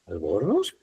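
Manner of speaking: ALBORNOZ softly, BOR stressed, questioning rise at the end; stress on middle syllable. Shown as a demonstration. Teacher doubting the wrong version, questioning stress on BOR in ALBORNOZ. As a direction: query ALBORNOZ with the weight on BOR. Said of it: "está mal".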